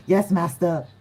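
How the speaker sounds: mock obedient servant voice; playful submissive lion; meek lion. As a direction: say it like a tamed pet. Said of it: "the lion energy is like".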